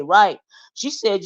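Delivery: ordinary delivery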